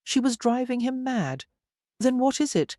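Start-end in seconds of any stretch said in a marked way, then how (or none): 2.0-2.8 tense, higher demand; tautly asking. A narrator's reading. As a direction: press her for the answer, strained. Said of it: "he asked tautly".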